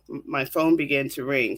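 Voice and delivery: usual speaking voice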